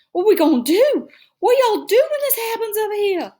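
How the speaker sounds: high, loud Southern mother in a panic; worried Southern. A narrator's reading. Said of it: "started talking Southern style when she got worried".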